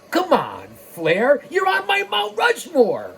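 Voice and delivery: ordinary talk, nothing marked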